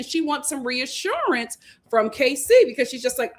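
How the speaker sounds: usual speaking voice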